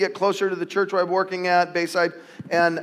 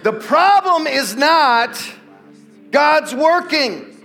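slow, emphatic and higher; slow key line; 6 semitones higher, 4 dB louder, much slower than usual. Acted. Pastor giving the church the key sentence, slow and emphatic. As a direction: land each word deliberately. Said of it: "Here's the key".

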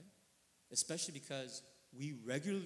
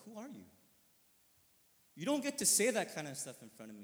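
acted high-pitched protest swelling louder; indignant father; 6 semitones higher, 10 dB louder than usual. Father confronting whoever insulted his child, acted indignation. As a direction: challenge them like a protective parent. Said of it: "How would I feel as his father?".

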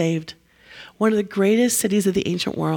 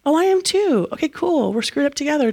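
much higher, sing-song mock cheer; mock cheerful reply; 7 semitones higher than usual. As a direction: sound airily pleased. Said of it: "this modern repentance".

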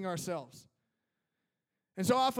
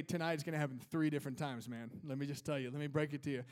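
lower, softer, breaking bad news gently; gentle, quiet warning; 6 semitones lower, 7 dB quieter, faster than usual. Jesus quietly breaking it to Peter, lower and softer. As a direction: drop the voice to let him down easy. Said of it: "And Jesus is like".

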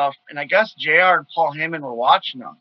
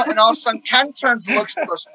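ordinary talk

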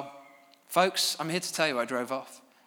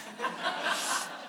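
quiet unvoiced chuckle; a little laugh under the breath; hushed airy chuckle; soft breathy laugh; 8 dB quieter than usual. Real, not acted. Laughs after builders' joke.

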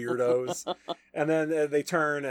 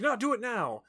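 insistent, higher-pitched mock command; retold impatient order; 10 semitones higher than usual. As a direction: insist on it, voice jumping up. Retold boss ordering his secretary.

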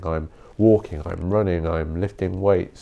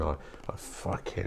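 muttered anger; 4 semitones lower, 15 dB quieter than usual. Retold or imitated. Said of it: "and I'm like".

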